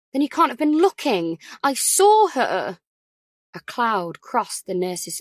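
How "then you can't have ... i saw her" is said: insistent. Acted. Ellie arguing with nurse.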